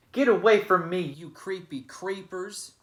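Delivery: loud gruff shout, the last words slowed; gruff bully yelling at caterpillars. Acted. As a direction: bellow them away from you. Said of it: "he shouted".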